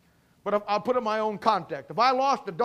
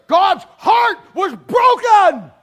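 loud, rising preacher's cry; hammering sermon voice, louder; full-force preaching; 7 semitones higher, 10 dB louder, slower than usual. Acted. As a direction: preach it hard to the congregation.

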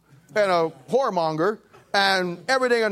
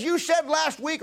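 excited lady; 9 semitones higher, slower than usual. Retold or imitated. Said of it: "She says".

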